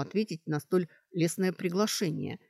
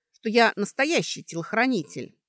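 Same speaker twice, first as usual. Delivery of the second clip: louder, higher, indignant insistence; 5 semitones higher, 5 dB louder than usual. A narrator's reading. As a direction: assert it with wounded certainty. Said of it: "возмутилась из-за слабой фразы". (возмущённо и уверенно)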